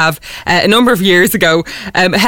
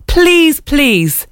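drawn-out plea, first one high, second dropping; mock begging, read from an old message; pleading; 4 semitones higher than usual. Host reading her unanswered message to the guest, pleading.

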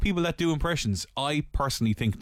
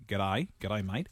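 low, softer Australian greeting; attempted Australian accent; 4 semitones lower, 6 dB quieter, slower than usual. Shown as a demonstration. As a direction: suggest an Australian g'day. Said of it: "What about, like".

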